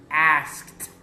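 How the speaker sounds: "Asked" ending in a crisp T sound. Shown as a demonstration. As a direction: say "Asked" loudly as askt. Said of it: "we pronounce E D as a T".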